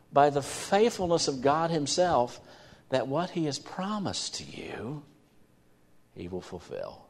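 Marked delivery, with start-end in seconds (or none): 2.9-7.1 hushed promise. Real, not acted. Preacher assuring the congregation, whispering.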